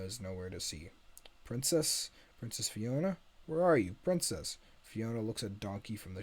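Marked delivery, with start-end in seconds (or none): 1.4-4.6 higher, searching call for someone unseen. Acted. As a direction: call into a dark empty room. Donkey searches for missing princess.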